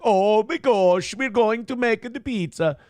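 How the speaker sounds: slow, sing-song mock Swedish voice; hammed-up Swedish accent, drawn out. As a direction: imitate a goofy Swede. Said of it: "a Swede talking like".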